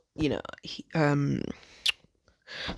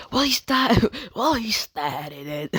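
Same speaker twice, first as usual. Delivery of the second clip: high, hammed-up New York accent; 7 semitones higher, 4 dB louder, slower than usual. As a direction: whine it out in mock New York. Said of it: "he sounds New York".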